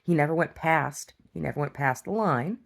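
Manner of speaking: usual speaking voice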